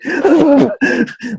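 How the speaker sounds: loud, high mock gasp; cold-sweat waking gasp. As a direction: act out jolting awake with a panicked gasp. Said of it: "wakes up in a cold sweat".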